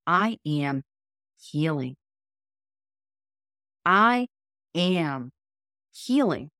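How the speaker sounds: slow, deliberate affirmation, each word spaced apart; driving it home. Shown as a demonstration. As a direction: say it like you mean it to your subconscious. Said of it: "By the third time I say that, I'm really driving it home".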